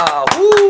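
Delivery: loud high whoo; whoo of relief. Real, not acted. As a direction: let out a big whoo. Relief after winner list. (크게 후 하고 외침)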